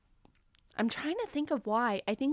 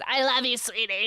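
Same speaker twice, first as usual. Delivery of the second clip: gushing starlet impression, loud at the start; Jennifer Tilly; 4 dB louder than usual. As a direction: mimic an actress greeting a fan effusively. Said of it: "And she goes".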